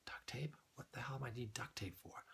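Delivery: hushed, puzzled aside to yourself; whispered thought. Retold or imitated. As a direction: whisper it like a baffled inner voice. Voicing his private puzzled thought.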